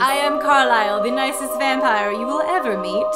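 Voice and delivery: mock-gracious vampire greeting; hammed-up Carlisle. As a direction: greet them like an overly friendly host. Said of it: "Carlisle's like".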